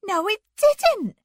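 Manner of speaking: high, loud, slow-punched denial; indignant high-voiced telling-off; sharp scolding. A narrator's reading. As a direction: scold sharply, pitched right up. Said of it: "Anne scolded sharply".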